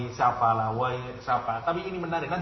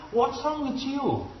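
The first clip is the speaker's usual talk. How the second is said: high, slow, baffled what's wrong; much higher incredulous English question; puzzled English speaker; 13 semitones higher, much slower than usual. Acted. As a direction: ask it as a bewildered foreigner. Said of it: "orang Inggris bilang".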